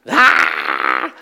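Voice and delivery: a sudden loud furious cry; angry roar. Retold or imitated. Preacher voicing Moses smashing the tablets, angry roar.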